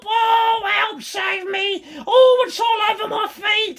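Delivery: very high, loud frantic shrieks; terrified hag. Acted. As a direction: shriek for rescue in terror. Mrs Twit terrified.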